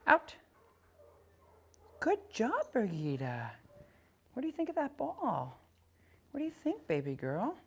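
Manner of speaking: high sing-song praise for the dog. Real, not acted. Trainer praising dog after retrieve.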